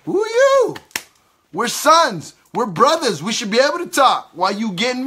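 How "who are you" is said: much higher, hammed-up disbelief on you; incredulous who are you. Preacher mocking a minister who won't be corrected, high incredulous. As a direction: squeal it in mock astonishment.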